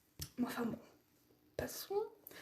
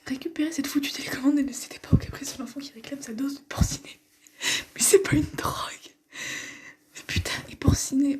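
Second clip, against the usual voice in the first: indignant whisper; 2 semitones higher, 5 dB louder than usual. Real, not acted. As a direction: whisper it in disbelief. Held whisper.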